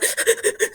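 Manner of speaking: quick, voiceless hitching gasps; imitated hyperventilating while crying; mock gasping for breath in rapid bursts; hyperventilating sobs. Shown as a demonstration. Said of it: "you know, hyperventilating".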